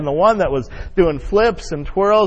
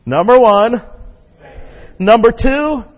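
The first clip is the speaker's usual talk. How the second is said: called out loud and higher; 7 semitones higher, 8 dB louder, slower than usual. Shown as a demonstration. Pastor calling out numbers for the congregation's reply, loud call. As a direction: shout the number to cue the crowd. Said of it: "This is a little bit different now".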